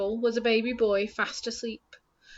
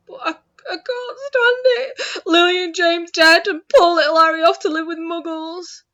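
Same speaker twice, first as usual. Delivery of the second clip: sobbing; 8 semitones higher, 11 dB louder than usual. Acted. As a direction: break down crying loudly. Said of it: "sobbed Hagrid".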